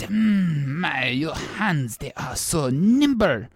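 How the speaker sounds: softly voiced French emperor; hushed, lingering French-accented compliment; Napoleon. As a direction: murmur admiringly in a French accent. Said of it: "and he said".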